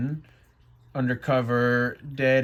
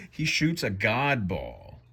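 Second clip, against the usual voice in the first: ordinary delivery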